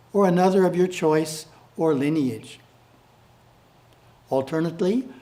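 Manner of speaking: usual speaking voice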